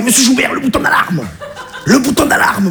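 high, urgent banker voice; whisper-shout the alarm like a scared banker; panicked banker. Retold or imitated. Banker in a hostage situation urging the alarm, high and panicky. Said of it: "il m'a fait".

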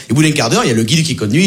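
ordinary delivery

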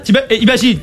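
ordinary delivery

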